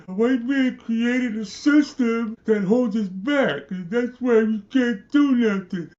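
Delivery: high, loud, drawn-out mock wail; mock crybaby. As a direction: wail it slowly like a crybaby, mocking. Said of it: "It's just crybabies to me".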